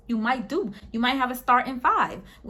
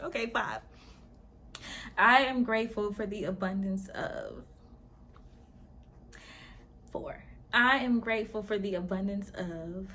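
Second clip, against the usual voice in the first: hypnosis countdown; 7 dB quieter than usual. Acted. Guiding the group into a hypnotic trance, softening. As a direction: lull them under, quieter each count.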